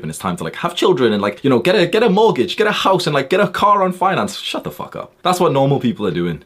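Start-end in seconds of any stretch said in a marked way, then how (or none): 4.4-5.1 whispered. Real, not acted. Dismissing normal people.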